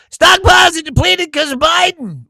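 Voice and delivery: high, loud, sneering mock of a chatter; mocking shout. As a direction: ham up the silly excuse.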